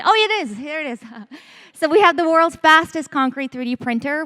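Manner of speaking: ordinary talk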